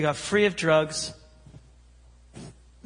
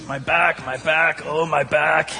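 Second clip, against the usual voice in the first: complaining lady; 3 semitones lower, 4 dB louder, slower than usual. Retold or imitated. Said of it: "the lady kept complaining about it".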